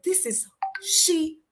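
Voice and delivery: very slow, stately, ceremonious; RDJ's grand formal phrasing. Retold or imitated. The mentor announces he has found her, slow stately proclamation. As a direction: pronounce it like a courtly proclamation. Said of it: "that's how he talks".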